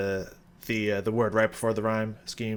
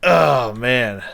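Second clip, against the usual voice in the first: impressed outburst; 2 semitones higher, 8 dB louder than usual. Real, not acted. Reviewer reacting to the song just heard, impressed outburst. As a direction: let out an awed, loud exclamation. Reacting right after the clip.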